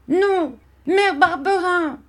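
put-on very high, drawn-out child's cry; desperate wail. A narrator's reading. Boy refuses and calls his mother, desperate wail. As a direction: wail it out slowly at the top of the voice.

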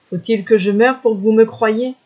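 plain reading voice

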